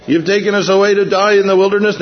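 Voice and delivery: imitating the Israelites' complaint; crying out to Moses. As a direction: whine at Moses like a scared crowd. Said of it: "what they're crying out here".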